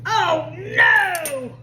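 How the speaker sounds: sudden startled cry; shocked at the spill; alarmed. Real, not acted. Flour spill mishap.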